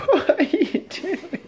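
high squeaky voice, laughing; squeaky dog talk. Real, not acted. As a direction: coo at the dog, high and slow.

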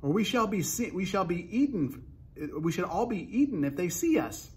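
anxious high-pitched hushing; urgent warning. A narrator's reading. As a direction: fret that you will all be caught. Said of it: "the earthworm whispered".

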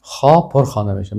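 usual speaking voice

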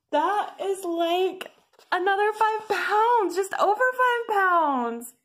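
high, thrilled amazement; real excitement, high-pitched; excited. Real, not acted. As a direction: marvel at it, pitch way up. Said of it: "I'm so excited".

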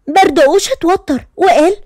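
plain reading voice

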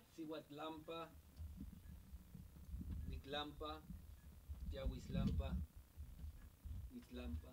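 soft, hushed ceremonial recitation; opening invocation. Acted. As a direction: recite it softly, with short reverent pauses.

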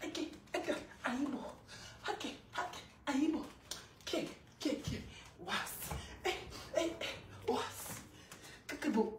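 near-whispered, broken exclamations; soft and voiceless, too overwhelmed to speak up; hushed disbelief. Real, not acted.

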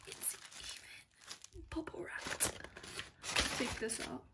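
awed whisper; softly whispered wonder. Real, not acted. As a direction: hush it like a secret find. Unboxing in hushed awe.

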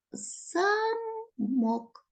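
Замок, first syllable ЗА stretched out and high; stressed first syllable. Shown as a demonstration. Teacher naming the second riddle's answer, Замок on ЗА. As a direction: lean on ЗА in Замок, drawn out. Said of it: "тут вже наголос є ось так".

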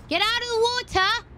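shrieking way up high; high yell. Real, not acted. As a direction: yell it out in a panic. Yelling at creature in water.

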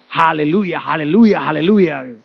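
imitated loud, low preacher's hallelujah; shouting preacher. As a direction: boom it out like a big preacher. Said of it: "kalau begitu khotbah teriak".

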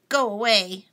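loud grouchy shout. A narrator's reading. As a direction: bark it at the other bug. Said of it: "shouted grouchy ladybug".

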